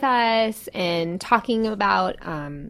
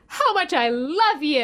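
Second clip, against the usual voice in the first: put-on sweet child; 6 semitones higher, slower than usual. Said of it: "He's like".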